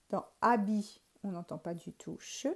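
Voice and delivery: normal talking voice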